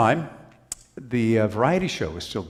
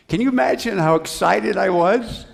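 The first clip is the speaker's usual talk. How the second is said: higher, louder burst of excitement; excited recollection; 10 semitones higher, 7 dB louder than usual. Retold or imitated. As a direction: relive the thrill out loud. Recalling his thrill at the job.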